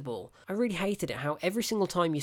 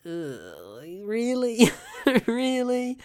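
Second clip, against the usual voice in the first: groan, then high, drawn-out disbelieving "really?"; incredulous scoff; 6 semitones higher, 4 dB louder, much slower than usual. Retold or imitated. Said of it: "and I'm like".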